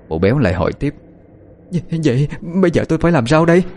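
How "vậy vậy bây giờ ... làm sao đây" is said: high, quick, flustered question; anxious stammer. A narrator's reading. Frightened woman asks captive for help, flustered. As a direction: trip into the question, pitch jumping up.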